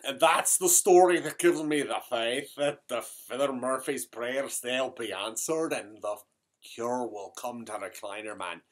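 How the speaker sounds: thick stage Irish brogue throughout. Acted. Comic Irish character telling a tale to the audience in a brogue. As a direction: keep the comic Irish lilt going.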